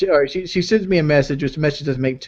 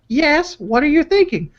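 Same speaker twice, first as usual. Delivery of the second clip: put-on high falsetto girlfriend voice reading texts; girly voice; 13 semitones higher, slower than usual. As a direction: read her lines in a squeaky girly voice. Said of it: "girly voice for this part".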